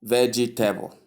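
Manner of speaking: "vegetable" in four syllables, VEJ-ee-TAY-bul; wrong pronunciation. Shown as a demonstration. Teacher shows learners the mistake in vegetable, wrong four-syllable pronunciation. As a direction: say "vegetable" with an added EE and a TABLE ending.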